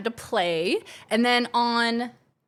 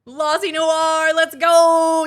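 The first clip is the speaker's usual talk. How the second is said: loud, drawn-out hype call; hype shout; 6 semitones higher, 9 dB louder, much slower than usual. Real, not acted. Hyping the Friday stream to chat, shouting. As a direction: cheer the stream on loudly.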